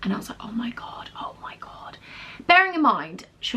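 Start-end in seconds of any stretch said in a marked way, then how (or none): 0.4-2.0 re-enacted hushed disbelief; retold stunned whisper. Employee reacting to manager guessing she's leaving, stunned whisper. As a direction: whisper it, stunned, almost voiceless.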